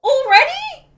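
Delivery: incredulous shout. Real, not acted. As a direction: yelp it in disbelief. Outraged at friend's game choice.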